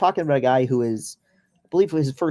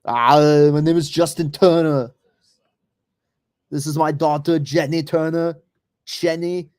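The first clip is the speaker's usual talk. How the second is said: put-on a heavy Austrian accent, pitched up, loud on the opening "Ah"; Schwarzenegger Austrian accent; 3 semitones higher, 5 dB louder than usual. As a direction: introduce yourself like an Austrian action star, thick Austrian accent. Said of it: "this, like, fucking six five Austrian".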